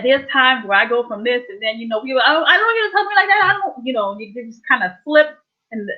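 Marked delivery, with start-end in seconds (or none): 2.1-3.9 quick, higher, mildly heated protest; mock upset snap. Telling off someone how they talk to her, mildly heated. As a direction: pretend to bristle at being told off.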